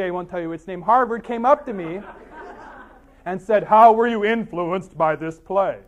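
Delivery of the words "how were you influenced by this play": loud, slow, drawn-out question; a hint of a professor, louder and slower; lightly voiced professor. Professor questions the poet after his reading, lightly voiced. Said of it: "this professor at this university".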